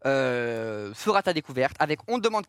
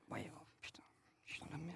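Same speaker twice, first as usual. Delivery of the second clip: whispered panic; 3 semitones lower, 26 dB quieter than usual. Real, not acted. Panicked aside after threat.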